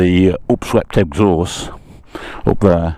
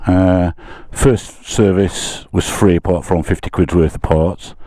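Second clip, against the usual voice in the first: ordinary delivery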